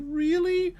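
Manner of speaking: very high, squeaky mock child asking; hopeful little kid. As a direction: mimic a five-year-old's hopeful question. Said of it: "He's like".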